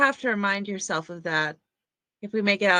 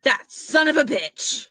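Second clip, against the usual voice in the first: slow angry curse; 4 semitones higher, 4 dB louder, slower than usual. Acted. Realizing betrayal.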